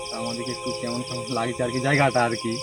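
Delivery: ordinary talk, nothing marked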